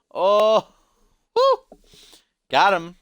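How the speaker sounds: high, loud celebratory whoop; gleeful whooping. Real, not acted. Streamer after teasing Chrissy with a pun, high gleeful whoop. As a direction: crow over a joke that landed.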